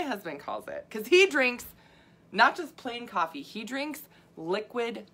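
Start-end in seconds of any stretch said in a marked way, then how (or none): none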